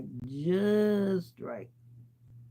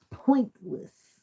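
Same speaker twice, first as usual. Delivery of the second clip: Pointless said high and loud with a falling end; emphatic repeat; 8 semitones higher, 6 dB louder than usual. Real, not acted. To viewers about useless injections, an emphatic repeat. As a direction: hammer Pointless home, louder and higher.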